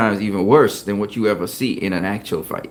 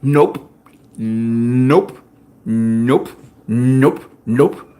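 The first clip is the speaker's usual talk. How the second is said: Floyd; much slower than usual. Retold or imitated. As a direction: separate taunting nopes, each rising. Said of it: "Floyd be saying".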